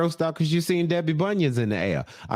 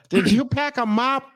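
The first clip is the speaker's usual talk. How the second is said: high, slow put-on voice; mock sheepish question; 11 semitones higher, 3 dB louder than usual. Joking as Debbie asking her helper, mock sheepish and high. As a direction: stretch it out high, teasing.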